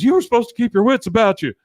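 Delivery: high, loud and fast, scolding; panicked yell. Retold or imitated. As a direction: shout it in a panic at the others. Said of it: "he goes".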